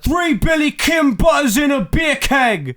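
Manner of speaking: high, slow, loud bad British impression; mock British accent. Rating the record on a British scale, bad British accent. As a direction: shout it in a hammed-up British accent. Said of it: "like, a British scale".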